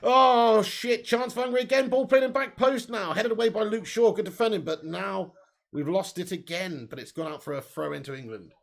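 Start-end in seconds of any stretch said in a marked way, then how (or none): 0.0-6.9 excited, higher play-by-play call; live commentary. Real, not acted.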